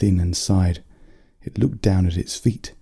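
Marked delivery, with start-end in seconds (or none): none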